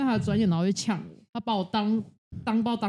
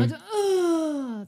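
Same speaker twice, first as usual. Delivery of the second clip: normal talking voice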